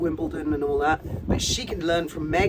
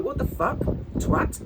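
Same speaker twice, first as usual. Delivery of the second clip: mock outrage; 6 semitones higher, 4 dB louder than usual. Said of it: "I'm like".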